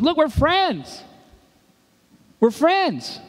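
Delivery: lightly voiced Peter brushing it off; mock Peter. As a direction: shrug it off as just friends. Peter deflecting Jesus, voiced.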